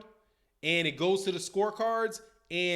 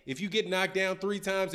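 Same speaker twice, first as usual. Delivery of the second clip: normal talking voice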